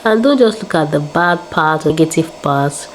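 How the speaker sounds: ordinary talk, nothing marked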